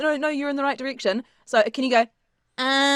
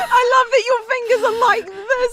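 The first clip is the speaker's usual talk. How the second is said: laughing; 13 semitones higher, 4 dB louder than usual. Real, not acted. Laughing at fingers pose.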